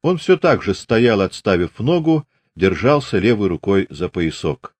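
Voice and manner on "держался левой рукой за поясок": plain reading voice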